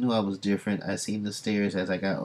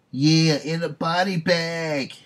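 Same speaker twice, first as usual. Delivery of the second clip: much higher, louder and slow, a sneering put-on yokel voice; high, loud, slow hillbilly threat; 9 semitones higher, 6 dB louder, slower than usual. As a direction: drawl it out as a cartoon thug. Redneck mob taunting her.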